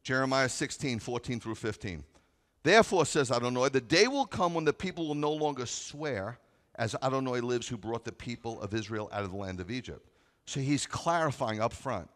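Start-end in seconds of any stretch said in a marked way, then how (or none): 2.6-10.1 loud, high preaching of the verse; full-force pulpit reading; preaching scripture. Acted. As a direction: proclaim it to the congregation.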